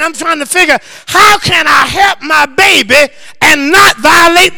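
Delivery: loud, high shouting sermon; full-force preaching. Acted. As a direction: whoop it up to the rafters.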